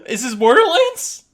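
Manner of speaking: loud, high amazed whoas with a laugh; excited whoa. Retold or imitated. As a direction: blown away, laughing with disbelief. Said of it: "and I was like".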